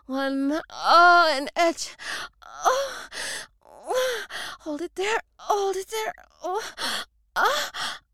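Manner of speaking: high loud gasping moans; loud climactic moaning. Acted. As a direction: cry out at the peak, high and loud.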